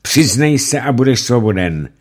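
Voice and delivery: high, loud command; commanding judge. Acted. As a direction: push her to confess. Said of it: "Rychtář řekl".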